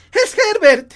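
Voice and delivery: very high, very loud cry; frantic scream. Acted. Mother insists her son is knocking, screaming frantically. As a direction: shriek it with wild certainty.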